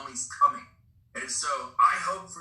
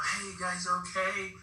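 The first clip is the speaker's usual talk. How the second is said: drawn-out mock yell, slightly raised pitch; higher, stretched-out calling voice; retold yell; 4 semitones higher, slower than usual. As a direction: call out slowly across a lake, held back in volume. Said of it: "I'm yelling to them".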